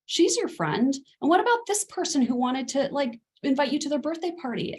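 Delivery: eager parental reassurance. Retold or imitated. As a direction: brush it off by cheerfully listing friends. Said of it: "be tempted to say".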